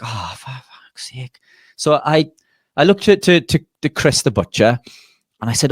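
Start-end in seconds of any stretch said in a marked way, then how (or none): 0.0-1.4 a breathy whispered curse under the breath; exasperated whisper. Retold or imitated. Whispering to himself as the customer asks to cut the fat off. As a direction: mutter it in despair, barely voiced. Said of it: "and it's like".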